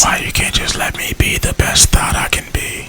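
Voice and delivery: very soft, voiceless whisper; whispered plea. Pretend. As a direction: whisper it like a wounded little aside. Mock plea slipped in under talk.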